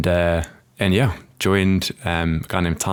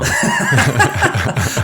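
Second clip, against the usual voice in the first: a sudden high burst of laughter, louder; real laugh; 13 semitones higher, 5 dB louder than usual. Real, not acted. Guest laughing at the host's accent request, bursting out in high laughter. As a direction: laugh it off, amused.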